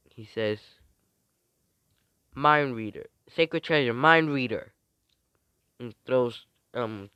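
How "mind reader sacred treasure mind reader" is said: loud summoning call; acted call-out. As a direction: announce the weapon's name loudly. Calling out a sacred treasure's name.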